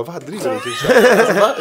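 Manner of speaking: high, loud baffled outburst, laughing; high loud laughing disbelief; shocked reaction. Retold or imitated. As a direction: act stunned and disbelieving. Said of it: "man blir så här chockad".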